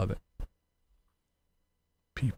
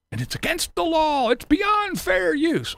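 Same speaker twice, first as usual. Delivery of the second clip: mock critic voice; 11 semitones higher than usual. Quoting the critic back at him in a mocking high voice. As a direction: parrot the critic's complaint in a whiny high voice.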